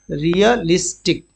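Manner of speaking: Realistic drawn out slowly, syllable by syllable; slow teacher demo of realistic; slow model pronunciation. Shown as a demonstration. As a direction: say realistic slowly and clearly for the class. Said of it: "क्या है?".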